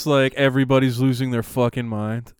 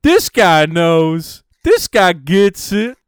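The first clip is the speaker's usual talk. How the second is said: fervent audience member; 7 semitones higher, 8 dB louder than usual. Retold or imitated. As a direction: declare it like a true believer. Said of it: "in the audience, like".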